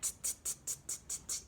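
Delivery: crisp, unvoiced tongue clicks; monkey tutting. A narrator's reading. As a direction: mimic scolding monkeys with quick tuts. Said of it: "monkeys only stomped their feet".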